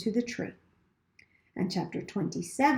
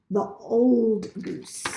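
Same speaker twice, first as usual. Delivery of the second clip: ordinary delivery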